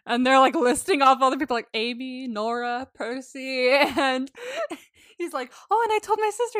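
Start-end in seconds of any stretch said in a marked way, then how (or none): 5.7-6.6 high-pitched, put-on admission; hammed-up high confession; mock sheepish confession. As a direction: blurt it out in a high voice. Retold awkward confession about sister.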